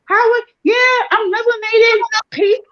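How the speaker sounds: very high, loud mock-cheery squeal; over-friendly greeting. Newcomer greeting women who aren't feeling her, over-eagerly friendly. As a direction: imitate yourself greeting everyone in a gushing high voice.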